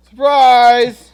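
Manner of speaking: loud high-pitched Surprise; excited shout. Pretend. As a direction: shout it like a party reveal. Mock reveal.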